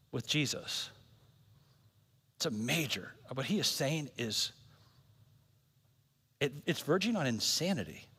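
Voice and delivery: hushed aside. Real, not acted. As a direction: drop the voice to confide. Soft reflective aside.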